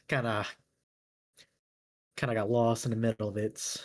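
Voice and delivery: normal talking voice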